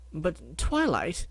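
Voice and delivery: ordinary delivery, nothing marked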